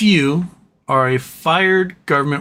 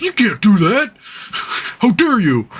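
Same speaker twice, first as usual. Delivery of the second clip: mock outrage; 6 semitones higher, much slower than usual. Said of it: "He's like".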